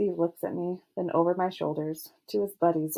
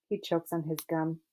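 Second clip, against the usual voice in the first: plain reading voice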